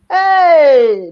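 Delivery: loud wail of despair, retold; anguished cry. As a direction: cry out as if the hope just died. Hears school plan cancelled.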